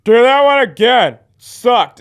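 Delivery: high, loud jeering heckle; heckling. Retold or imitated. Drunk audience member heckling a comedian, loud jeer. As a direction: jeer at the comic. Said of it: "and you're just like".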